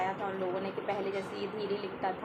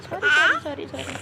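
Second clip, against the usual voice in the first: voicing the toddler; 6 semitones higher, 8 dB louder, much faster than usual. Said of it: "देखो वो कह रही".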